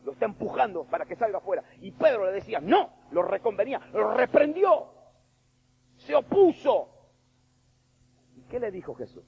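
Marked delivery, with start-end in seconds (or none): 0.0-7.1 high, loud, building preacher's cry; preaching at full force. Real, not acted. Preacher shouting to the congregation about Peter's rebuke. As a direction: push it out like a fiery sermon peak.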